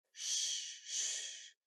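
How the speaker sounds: put-on whispered hush to a child; soft breathy shh to quiet her; shushing. Mother hushing her frightened daughter, whispered.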